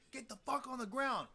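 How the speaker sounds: retold cop yelling at teens; cop shouting. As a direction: bark it like an armed cop. Cops shouting orders at them.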